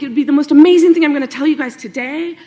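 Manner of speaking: over-the-top excited demo; really enthusiastic. Shown as a demonstration. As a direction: sell it like the most amazing news ever. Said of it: "or I could be really enthusiastic".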